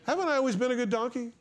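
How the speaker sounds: fast, amused repeat of the donkey's whine; hammed-up donkey plea through a laugh.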